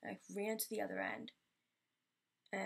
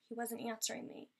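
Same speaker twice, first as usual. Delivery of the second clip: normal talking voice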